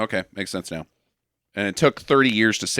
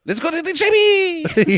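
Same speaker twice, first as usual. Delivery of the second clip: very high, garbled mock dub; high-pitched voiceover; 4 dB louder than usual. Showing the pilot's unintelligible lines, high-pitched. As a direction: squeal out a nonsense line. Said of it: "nonsensical voiceover in a high-pitched voice".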